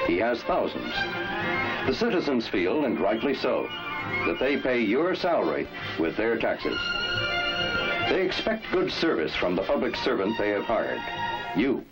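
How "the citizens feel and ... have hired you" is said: ordinary talk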